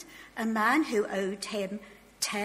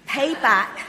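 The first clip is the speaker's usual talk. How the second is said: forceful shout, much louder; loud demand; 3 semitones higher, 12 dB louder than usual. A narrator's reading. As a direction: bark the order at the debtor. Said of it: "he demanded".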